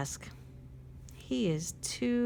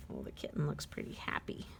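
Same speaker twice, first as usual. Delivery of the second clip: soft, fast, lower whisper; 5 semitones lower, 7 dB quieter, faster than usual. Real, not acted. Reading to a child, a quick soft whisper. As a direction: hush it like a bedtime secret.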